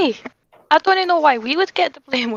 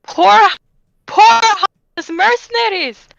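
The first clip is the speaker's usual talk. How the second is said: incredulous mock echo; 8 semitones higher, 6 dB louder, slower than usual. Mocking townmaster's words incredulously.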